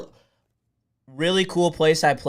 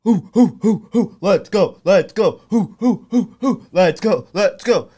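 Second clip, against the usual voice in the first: raised, rhythmic whoops and cheers; celebratory whooping; 5 semitones higher, 5 dB louder than usual. Real, not acted. Streamer cheering the draft with viewers, excited whooping. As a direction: chant it like a hyped-up fan.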